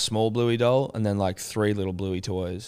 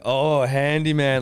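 drawn-out, louder mock jeer; teasing put-down; 4 semitones higher, 5 dB louder, slower than usual. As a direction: draw it out to needle him. Imitates Marina's teasing put-down.

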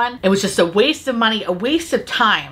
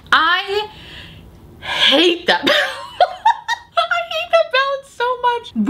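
high, laughing through the words. Real, not acted. Reviewer laughing at the palette she hates, laughing. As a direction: laugh while you hate it.

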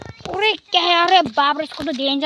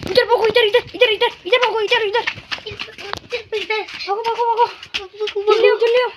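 high-pitched, hurried repeated calls; rally everyone to flee, voice pitched up high; urgent calling out; 7 semitones higher, faster than usual. Real, not acted. Vlogger calling companions to run from danger, urgent.

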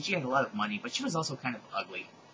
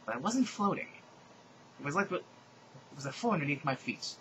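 normal talking voice

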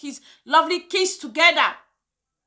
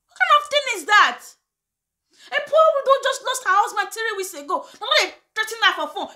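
higher pitched disbelief, quick at the end; outraged; 6 semitones higher, faster than usual. Real, not acted. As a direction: sound shocked at the cruelty. Outraged over the widow.

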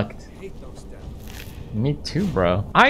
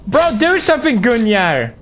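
high call for help, dropping at the name; urgent call; 8 semitones higher than usual. Real, not acted. Player urging game companion to act, urgent call.

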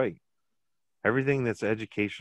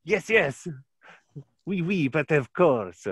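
high, loud, slow mock French; a silly Frenchman clowning to make his friend laugh; hammed-up French; 9 semitones higher, 5 dB louder, slower than usual. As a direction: ham it up like a cartoon Frenchman. Hamming up French joke.

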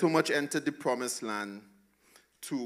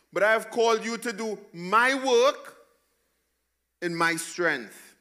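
higher, loud commanding divine voice; voicing God; 8 semitones higher, 8 dB louder than usual. Pastor voicing God's promise of strength, loud and firm. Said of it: "God says".

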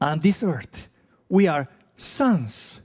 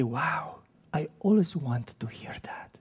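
awed whisper; hushed as if hearing it for the first time; a listener's whispered wonder; 7 dB quieter than usual. Pretend.

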